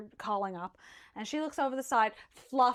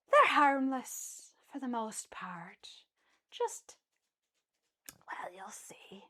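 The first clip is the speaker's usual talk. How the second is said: knowing whisper; 3 dB quieter, slower than usual. Acted. GM voices mischievous Sapphire.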